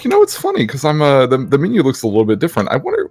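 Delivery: a hint of a sing-song curious voice; innocent puzzled self. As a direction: play it falsely innocent, wondering aloud. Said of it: "and I'm like".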